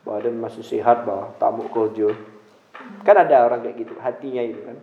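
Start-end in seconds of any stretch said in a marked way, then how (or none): 0.0-2.1 low, sneering voice of a mean person; lightly voiced sneering scold; mean-hearted scolder. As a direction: look down on the beggar. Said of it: "hatinya jelek".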